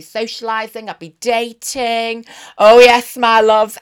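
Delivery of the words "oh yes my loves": cheeky loud aside. Real, not acted.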